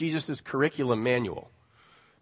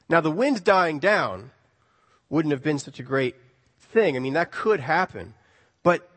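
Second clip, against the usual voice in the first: normal talking voice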